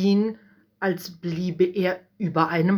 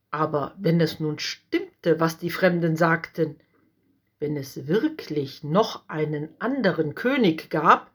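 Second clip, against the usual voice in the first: ordinary delivery, nothing marked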